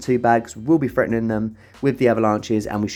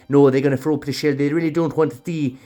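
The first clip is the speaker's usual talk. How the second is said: hammed-up Irish commentary, pitched higher; ridiculous Irish accent; 4 semitones higher, 4 dB louder than usual. Gamer commentating on Stone Edge in a daft Irish accent. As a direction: slip into a silly, exaggerated Irish accent. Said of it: "doing that in a ridiculous Irish accent".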